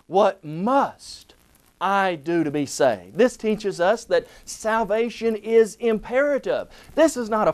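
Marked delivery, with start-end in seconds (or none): 0.1-3.2 "must" pressed hard, rest lower; "must" punched, then the question drops away; stress on MUST. Shown as a demonstration. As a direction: lean on "must". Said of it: "we would ask it this way".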